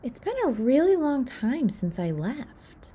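ordinary delivery, nothing marked

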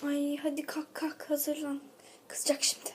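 urgent hushed whisper. Acted. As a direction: whisper so the teacher won't hear. Whispering to sleepy friend. (fısıltı)

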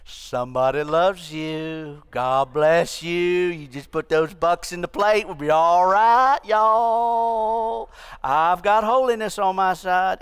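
slow, oily Texan preacher drawl; hammed-up Texan preacher pitch; fake TV preacher. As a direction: sell it like a smarmy televangelist. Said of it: "then fake holiness is".

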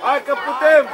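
rally the team with short shouts; urging shout. Real, not acted. Urging young players from sideline. (îndemn strigat)

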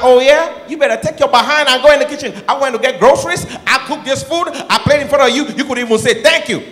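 high loud rant; angry wife. Retold or imitated. Wife scolding husband about thanks, high loud rant. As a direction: scold him into the kitchen. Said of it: "She was like".

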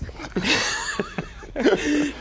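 high falling laugh; falling chuckle; amused laugh. Real, not acted. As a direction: laugh at the teasing. Joke about the host's coming days.

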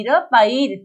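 usual speaking voice

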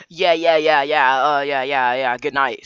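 imitating a sarcastic good night. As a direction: brush him off with fake agreement. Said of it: "Sorry, sorry, sarcastically".

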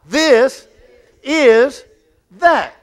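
loud, high, word by word; call and response. Shown as a demonstration. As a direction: punch This, is, that one at a time for the crowd to echo. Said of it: "Say it again".

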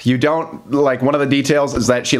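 ordinary delivery

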